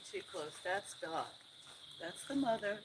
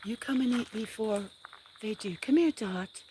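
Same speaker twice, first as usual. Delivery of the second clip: higher, louder call to the cat; 6 semitones higher, 4 dB louder than usual. Real, not acted. Owner calls Dot over to eat, loud high call. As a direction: call the cat across the yard.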